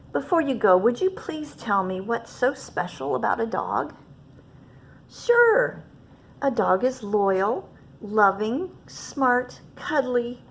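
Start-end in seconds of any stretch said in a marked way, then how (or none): 5.1-5.8 high bright squeak of agreement; eager sure. Acted. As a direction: jump at the chance. Clerk eager to hear.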